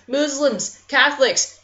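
normal talking voice

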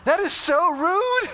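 high, drawn-out mock indignation; mock outrage. Pastor feigning outrage at his mechanic friend, high and slow. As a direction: pretend to be offended, stretch every word.

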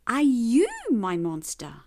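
ordinary delivery

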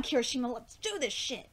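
ordinary talk, nothing marked

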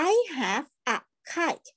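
very slow, word by word; slow model reading. Shown as a demonstration. Teacher reading to online class, slow and clear. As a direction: read "I have a kite" slowly for children to repeat. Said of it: "ครูให้อ่านตามนะ".

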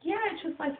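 very high, put-on questioning voice; impersonated therapist. Therapist asking about another doctor, high-pitched impersonation. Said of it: "I like impersonating people".

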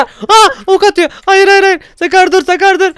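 high, loud laughing panic; begging a teammate to hold fire, high and frantic; panicked pleading. Real, not acted. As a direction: beg Sakar to stop, half laughing. Sakar shooting arrows at him.

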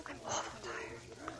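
breathy near-silent slow whisper; exhausted whisper. Acted. Worn-out woman telling the lieutenant she's drained, whispered. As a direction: let it fade out on a sigh. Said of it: "I'm tired".

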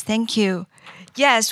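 ordinary talk

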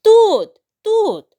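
very high, loud urgent yelp; shouting. Acted. Brothers crying out from inside the crocodile. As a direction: shout to be heard. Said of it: "разом закричали".

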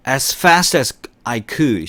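very slow, every word drawn out; teacher's slow repeat-after-me pace; slow model reading. Shown as a demonstration. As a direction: read it slowly for students to repeat. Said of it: "시작".

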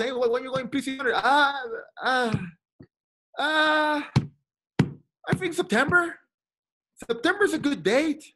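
a mock-timid rookie stalling for an answer; hammed-up nerves before naming a date; nervous hesitation. His nervous self being questioned.

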